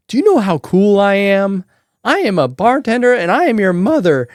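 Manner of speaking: hammed-up furious mom tirade; pissed-off mom. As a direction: imitate an outraged mother, wounded pride mounting. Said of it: "my mom is pissed".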